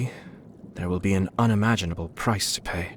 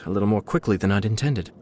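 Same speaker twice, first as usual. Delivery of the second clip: ordinary delivery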